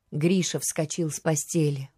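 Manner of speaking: ordinary delivery, nothing marked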